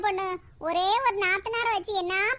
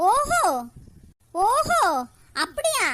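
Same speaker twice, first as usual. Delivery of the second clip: mocking oho; 4 dB louder, slower than usual. Older sister mocks the young girl's threat, sarcastic. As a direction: scoff at her bluster.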